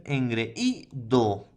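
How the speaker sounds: "engreído" drawn out slowly, syllable by syllable. Shown as a demonstration. Teacher showing the E-I hiatus: slow syllables. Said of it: "Con E, I".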